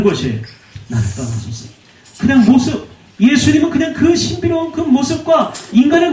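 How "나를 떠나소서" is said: "나를 떠나소서" dropped much softer and lower; hushed plea. Acted. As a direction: breathe "나를 떠나소서" as a faint, humbled plea. 같은 고백을 작게 반복. ("나를 떠나소서"를 훨씬 작고 낮게)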